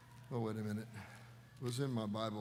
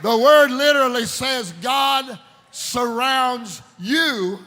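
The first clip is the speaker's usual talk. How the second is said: loud, slow, ringing sermon voice; fiery preacher calling out; full-force preaching; 8 semitones higher, 12 dB louder, slower than usual. Acted. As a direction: preach it out to the whole church.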